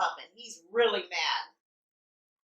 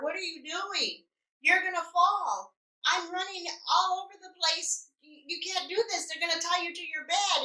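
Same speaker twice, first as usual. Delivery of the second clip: retold angry scolding; 7 semitones higher, 3 dB quieter than usual. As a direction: imitate an exasperated nurse scolding a patient. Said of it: "he's so mad, he's so angry".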